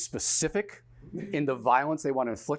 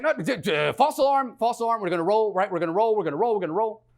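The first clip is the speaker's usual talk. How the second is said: higher, louder, repeating insistently; flustered director; 7 semitones higher, 6 dB louder, faster than usual. Retold or imitated. Director to crew after false alarm, flustered and loud. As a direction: hurry everyone past the interruption.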